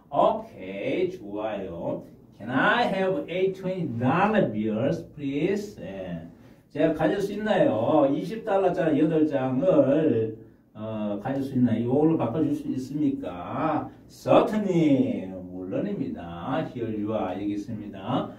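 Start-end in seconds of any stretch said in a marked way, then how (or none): 2.4-5.8 slow and clear; slow model request. Shown as a demonstration. Teacher modelling a polite request slowly. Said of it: "teacher models request". 14.1-15.3 drawn out slowly; slow model reply. Shown as a demonstration. Teacher modelling the clerk's reply slowly. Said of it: "teacher models reply".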